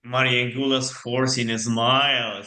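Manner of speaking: ordinary talk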